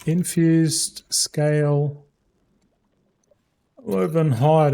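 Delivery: infused scale woven hide said very slowly, word by word; slow careful dictation while typing. Shown as a demonstration. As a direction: spell it out slowly as you type it. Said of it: "I can't even spell".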